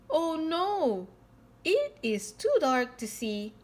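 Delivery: ordinary delivery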